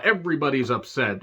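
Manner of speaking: ordinary talk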